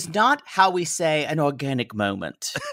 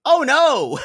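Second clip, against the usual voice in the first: a higher, louder laughing cry of dismay; hammed-up loud oh no; mock dismay; 11 semitones higher, 6 dB louder than usual. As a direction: shout it in mock horror. Voicing the father.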